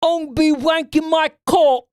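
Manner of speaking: a crude put-on British lad; silly high British voice; mock British voice.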